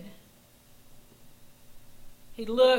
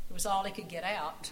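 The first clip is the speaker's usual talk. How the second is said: ordinary delivery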